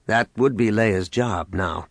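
ordinary delivery

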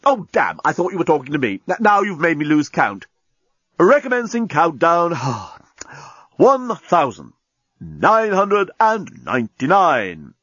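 flustered talking bomb, resuming countdown. Acted. Bomb losing count and restarting, huffy then ceremonious.

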